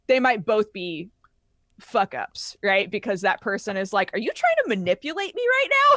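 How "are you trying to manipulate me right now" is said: mock accusing question, pitched way up; suspicious accusation. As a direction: act out a high, incredulous challenge. Acted suspicious character confronting player.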